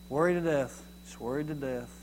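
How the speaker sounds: soft, subdued worrier's sigh of words; mock worrying. Voicing a worrier's saying.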